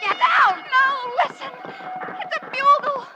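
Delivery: rising, urgent excited calls; excited shouting. Acted. Dallas urging everyone to hear the bugle, excited high shouts. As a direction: make them hear the bugle. Hearing cavalry bugle in battle.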